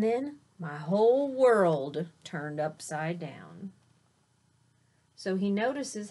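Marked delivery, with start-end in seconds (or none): none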